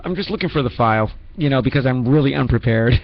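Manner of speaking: ordinary delivery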